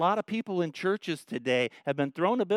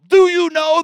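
pulpit-pounding yell; 13 dB louder than usual. Retold or imitated. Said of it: "the next time the guy yells".

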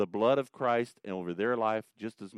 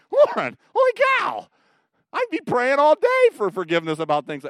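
much higher and much louder, hammed-up shock; mock astonishment; 13 dB louder than usual. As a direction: blurt it out in exaggerated disbelief. Mock shocked reaction to Lauren.

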